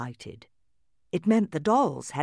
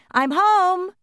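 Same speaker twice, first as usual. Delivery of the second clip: high, loud happy shout home; 10 dB louder than usual. A narrator's reading. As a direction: shout it from the front door. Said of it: "heard Kate shout".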